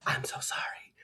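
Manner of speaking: breathy whispered apology. Retold or imitated. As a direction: whisper it under your breath. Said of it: "and he was like".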